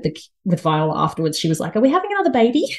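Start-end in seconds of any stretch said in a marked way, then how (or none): 1.8-2.6 lightly voiced eager little girl; a hint of hopeful child, higher; small child's question. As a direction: lift the pitch like an inquisitive toddler. Small daughter's question, retold.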